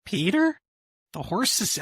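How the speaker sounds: high, rising cartoon call; cartoon character impression. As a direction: pitch the name way up like a cartoon character. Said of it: "Mal, let's hear yours".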